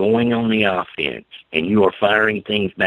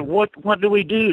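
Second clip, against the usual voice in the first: mock panic; 13 semitones higher than usual. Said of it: "And they would freak out".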